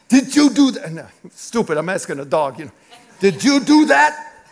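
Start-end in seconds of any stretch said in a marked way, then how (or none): 0.1-0.9 high, loud accusing question; mock-stern, raised-pitch interrogation; acted dog scolding. 3.2-4.4 drawn-out, high, loud accusation; slow, exaggerated scolding; acted dog scolding.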